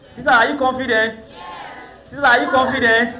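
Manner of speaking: loud rally shout to the sisters; hyped crowd call. Real, not acted. MC hyping up the crowd.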